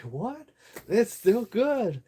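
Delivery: high, slow, amazed ad voice; mock commercial presenter. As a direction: play up the product surviving like a cheesy TV ad. Mock commercial after car crush.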